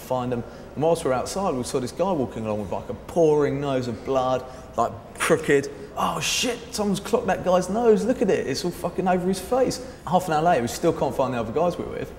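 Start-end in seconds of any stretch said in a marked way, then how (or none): 6.0-9.8 shocked. Retold or imitated. Seeing the bloodied nose.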